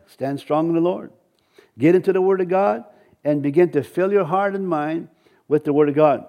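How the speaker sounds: ordinary talk, nothing marked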